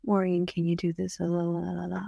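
softly lulling imitation; imitated soft whispering. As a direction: imitate a silky, hushed earpiece voice. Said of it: "whispers, sometimes she'll whisper things".

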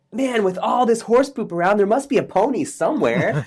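put-on the optimistic kid explains to his parents, in a high eager voice. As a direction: play the kid who sees only the good. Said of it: "And the kid says".

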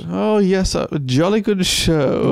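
mock English accent. Co-host teasing about an accent picked up, mock English. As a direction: put on a drawn-out English accent. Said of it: "a little bit of an English".

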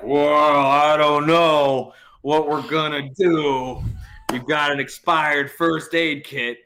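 slow, drawn-out official hemming and hawing; mock dilemma. Cop stalling a driver over an expired first aid kit, mock dilemma. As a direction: draw the words out as if it's a grave problem.

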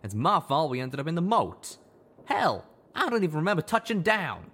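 high, loud, bitter self-reproach; he beats himself up; self-blame. Acted. Calamity blaming himself.

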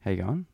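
rising lilt up to the end of "How you going?"; Australian question intonation. Shown as a demonstration. Showing a host how Australians make everything a question, rising intonation. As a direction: let "going?" swing upward as a question. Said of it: "everything's a question".

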